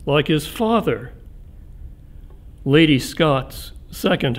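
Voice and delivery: measured, projected reading with long pauses between phrases; poetry reading. Real, not acted. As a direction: read the poem out to a hall, phrase by phrase.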